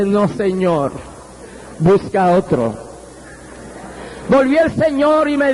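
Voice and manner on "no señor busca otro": low, soft plea, understated tears; tearful refusal. Retold or imitated. Preacher retells refusing God's call, a low tearful plea. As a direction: plead quietly, sinking lower at the end. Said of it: "pero llorando".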